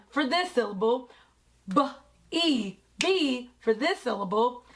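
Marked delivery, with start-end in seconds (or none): 1.7-3.5 "b, e" apart, then blended as "bee"; sounding out. Shown as a demonstration. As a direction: say "b", pause, "e", then join them. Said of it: "sound out and break down".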